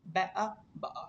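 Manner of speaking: "बे आ बा आ" with glottal catches for the Ts; British accent, cut each T to a throat stop. Shown as a demonstration. Teacher giving class another silent-T example, British glottal stops. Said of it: "टी होता है उसमें हम उसको बिल्कुल ही silent कर देते".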